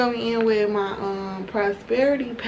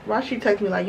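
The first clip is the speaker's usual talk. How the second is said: ordinary talk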